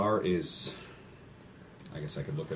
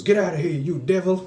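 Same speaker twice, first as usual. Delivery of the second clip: high, loud mock preacher yell; outraged pastor; 12 semitones higher, 8 dB louder than usual. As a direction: cast out the devil like a hammed-up pastor. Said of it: "And the pastor goes".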